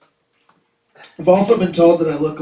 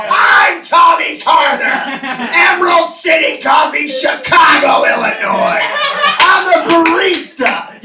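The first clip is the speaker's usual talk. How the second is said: high, loud heroic yelling; over-the-top barista hero shouting; hammed-up hero shout; 10 semitones higher, 7 dB louder than usual. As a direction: bellow it like an action movie cop. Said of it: "And I go".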